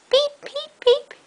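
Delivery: squeaky high-pitched beep beep beep like a little bird. Retold or imitated. Parent imitating a bird for a toddler, squeaky high beeps. Answering what a bird says.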